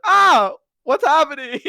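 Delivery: put-on high, loud cry of childish alarm; mock freaked-out. Retelling a scary childhood movie, hammed-up high freak-out. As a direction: act out a kid's panic. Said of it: "that freaked me out".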